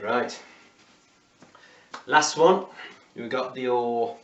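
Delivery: usual speaking voice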